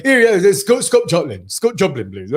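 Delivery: mock royal mumble; Prince Charles. Royal guest requests a tune from the entertainer, mock royal voice. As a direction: put on a caricatured royal voice. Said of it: "And he went".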